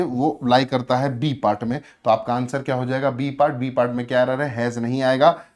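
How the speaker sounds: usual speaking voice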